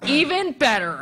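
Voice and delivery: playfully over-keen customer; mock delight. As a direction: relish it with hammed-up eagerness. Retold delighted reply to seller.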